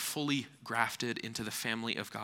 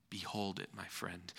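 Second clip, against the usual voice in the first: soft, low and intimate; tender; 3 semitones lower, 9 dB quieter than usual. Acted. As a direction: speak it gently to one friend. Tender address to congregation.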